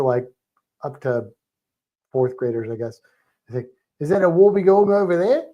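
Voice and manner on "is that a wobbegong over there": higher, louder Aussie question; teacher modelling a broad Australian accent. Shown as a demonstration. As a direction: lift it into a rising Aussie question. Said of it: "say it in an Australian accent".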